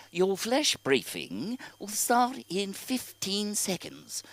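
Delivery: put-on very slow, lilting assistant accent; Alexa's great accent. Alexa answering her owner, alluring put-on accent. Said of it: "she has this great accent".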